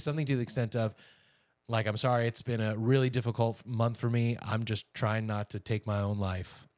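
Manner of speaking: normal talking voice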